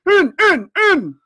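loud, very high repeated command; furious shout. A narrator's reading. Blind man urges hesitating men inside, shouting in rage. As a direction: shout them in with eager rage. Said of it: "he shouted".

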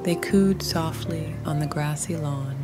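usual speaking voice

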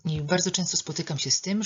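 normal talking voice

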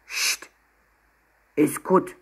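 grumbly little voice from a backpack; grumbling. A narrator's reading. Hidden penguin hushes Emma from the backpack, grumbling. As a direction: grumble it under your breath. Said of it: "brummte der Rucksack".

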